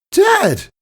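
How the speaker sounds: high, loud startled call; alarmed cry. Acted. Daughter watching father fall, high alarmed cry. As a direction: cry out as he collapses. Father falls to his knees.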